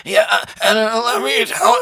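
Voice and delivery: high mocking impression of a politician; Robert Kennedy. Carrying on the joke about a hard interview, mocking impression. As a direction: slip back into the high, strained politician voice. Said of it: "I'm making fun of him".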